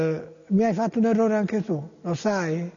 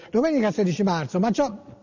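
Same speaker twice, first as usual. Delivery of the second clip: ordinary delivery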